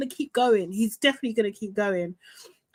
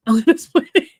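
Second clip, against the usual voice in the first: laughing tease; 7 dB louder, faster than usual. Real, not acted. Teasing viewer with spoiler.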